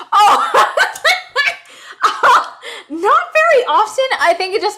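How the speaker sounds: laughing, voice high and loud. Real, not acted. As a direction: laugh it off, pitched well up. Laughs at angry Judy question.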